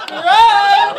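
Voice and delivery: loud, high shout of CRIME; hammed-up triumphant bellow; gleeful yell. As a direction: holler it like a party cheer. Gleefully yelling crime as a bit.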